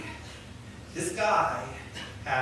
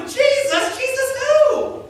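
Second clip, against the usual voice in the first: puzzled surprise; 14 semitones higher, 4 dB louder, slower than usual. Retold or imitated. Paralytic reacts, puzzled.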